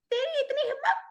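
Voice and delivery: very high squeaky little creature; tiny monster voice. Shown as a demonstration. The shrunken Bogart threatens Harry in a very high tiny voice. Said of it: "Bogard की आवाज कैसी हुई".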